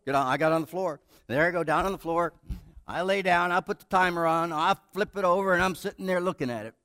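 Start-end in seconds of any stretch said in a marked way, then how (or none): none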